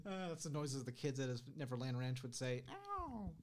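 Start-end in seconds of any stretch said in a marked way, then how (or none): 2.7-3.3 high-pitched yelp. Retold or imitated. [name] noise imitation.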